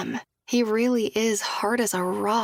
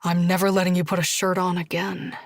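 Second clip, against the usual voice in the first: lightly lowered Josh voice; 2 semitones lower than usual. Acted. Josh asks Julia gently, lightly voiced man.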